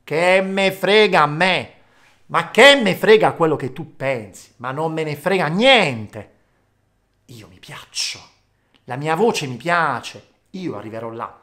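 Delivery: loud, slow, defiant singer voice. Retold or imitated. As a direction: brush off the critics loudly. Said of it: "Sono loro che hanno detto". (cantanti sprezzanti, forte e lento)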